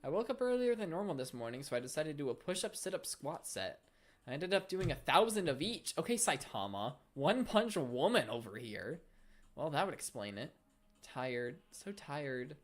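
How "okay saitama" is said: usual speaking voice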